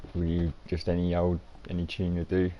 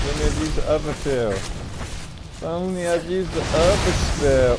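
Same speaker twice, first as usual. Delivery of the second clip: mock lament; 8 semitones higher, 6 dB louder, slower than usual. Lamenting wrong spell choice.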